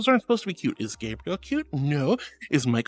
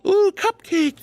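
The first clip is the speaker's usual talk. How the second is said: delighted gasp; 12 semitones higher, 3 dB louder, slower than usual. Real, not acted. Delighted by compliment.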